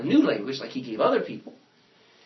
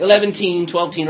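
mock counting; 3 semitones higher, 10 dB louder than usual. Shown as a demonstration. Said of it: "Something happens".

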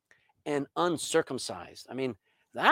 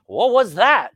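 mock indignant shout; 4 semitones higher, 9 dB louder, slower than usual. Parent reacting to son's defiance.

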